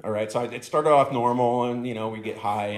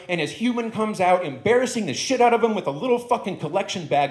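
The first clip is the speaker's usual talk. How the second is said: indignant ranting dog; 6 semitones higher than usual. Acted. The dog demands the high guest see the inequality, outraged. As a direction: push each grievance higher and harder. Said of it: "he says".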